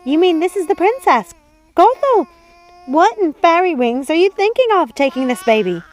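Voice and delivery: put-on high, scolding old woman; shocked high-voiced old servant; old housekeeper. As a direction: fuss at her mistress in a high old voice.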